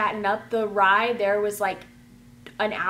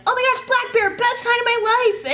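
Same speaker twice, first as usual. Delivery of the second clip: mock fangirl squeal; 14 semitones higher, 6 dB louder than usual. Whole camp repeats the joke.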